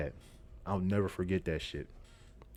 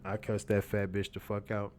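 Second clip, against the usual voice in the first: ordinary talk, nothing marked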